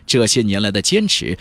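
usual speaking voice

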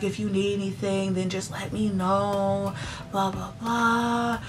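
soft, high, drawn-out reassurance; mock-tender, lingering on each phrase; imitated sweet concern. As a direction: mimic the manager being oh-so-nice. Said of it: "she was being so nice to her".

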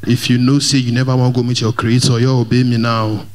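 normal talking voice

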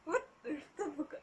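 high, slow, trembling swear; scared exclamation. Real, not acted. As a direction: gasp it out in fear. Seeing the shadow.

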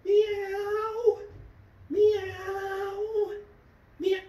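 high, long drawn-out meows; cat meow. Pretend. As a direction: imitate a cat with sustained high mews. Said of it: "that's the cat".

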